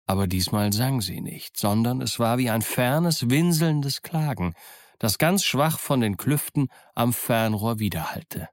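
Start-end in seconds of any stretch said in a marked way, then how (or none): none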